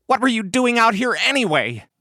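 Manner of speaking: high, loud, cross question; shouting. Acted. Massasoit grills his revived friend, shouting. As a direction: demand an answer loudly. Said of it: "Massasoit shouted back".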